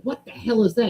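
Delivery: startled. Retold or imitated. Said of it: "You go".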